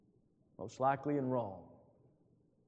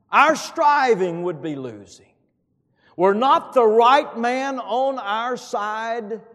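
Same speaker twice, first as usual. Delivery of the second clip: high, loud, slow declaiming of the verse; full-force pulpit recitation, higher and louder; preaching hymn lyrics; 10 semitones higher, 13 dB louder, slower than usual. Acted. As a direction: proclaim each hymn line like a battle cry. Preaches the hymn's second verse.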